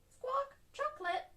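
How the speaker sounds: high squawking parrot echo; squawk, then repeat it like a parrot; Polly Parrot. A narrator's reading. Polly Parrot copies Susie Sheep's order, high squawky voice. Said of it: "Polly Parrot copied".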